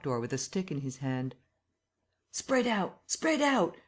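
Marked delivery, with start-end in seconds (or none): 2.3-3.0 high shouted order; raised command; calling out. A narrator's reading. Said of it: "cried some one". 3.1-3.8 even higher repeated shout; rising urgent call. A narrator's reading. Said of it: "cried some one".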